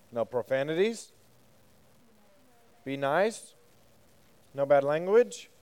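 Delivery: ordinary delivery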